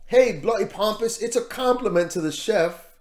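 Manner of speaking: imitating a British chef with a poor accent; bad British accent. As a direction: do Gordon's British voice, badly. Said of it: "My, my accent is horrible".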